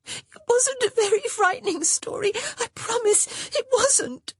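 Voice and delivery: very high-pitched tearful wail; gasping through sobs. A narrator's reading. Mercy reporting her scolding to her sisters, sobbing. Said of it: "gasping through her sobs".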